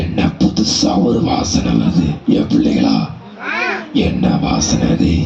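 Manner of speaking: low slow predator voice; deep lion growl. Acted. Lion sniffs out the goat, deep slow voice.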